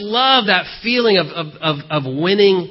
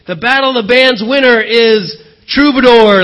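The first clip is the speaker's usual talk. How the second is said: big, loud announcer calling the winner; 4 semitones higher, 8 dB louder than usual. Retold or imitated. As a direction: boom it out like an MC naming the winner. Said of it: "they come up and they say".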